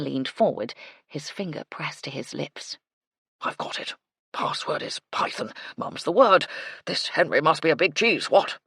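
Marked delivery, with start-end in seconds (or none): 3.4-8.6 hushed password, then louder bluster; old colonel sharing a secret badly; conspiratorial colonel. A narrator's reading. Colonel taking a python for a password, conspiratorial bluster.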